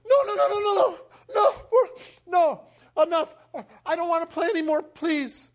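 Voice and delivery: high, loud, frantic begging; desperate pleading child. Retold or imitated. Boy between fighting parents, desperate pleading. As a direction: cry out to stop the fight.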